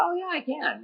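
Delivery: high, soft retold quote; imitating wife's realization. Husband retells wife tasting the beer, high-pitched mock realization. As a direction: jump up high and soft, mimicking her coming round.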